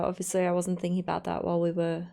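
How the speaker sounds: usual speaking voice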